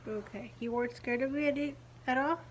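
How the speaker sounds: ordinary delivery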